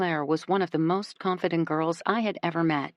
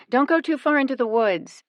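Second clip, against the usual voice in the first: raised voice, calling after; 8 semitones higher, 6 dB louder than usual. A narrator's reading. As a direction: call it out across the camp. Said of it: "Nikki calls out".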